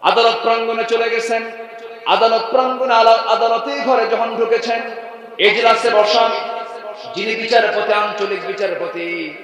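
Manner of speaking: high, loud declamatory sermon chant; ringing preacher's delivery; full-force preaching. Acted. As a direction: proclaim it to the whole gathering on a high held pitch.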